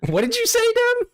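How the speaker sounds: very high, loud stunned squeal; shocked shriek. Real, not acted. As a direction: shriek in disbelief at what you heard. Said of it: "Oh, they said".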